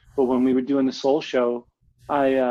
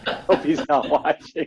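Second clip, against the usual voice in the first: normal talking voice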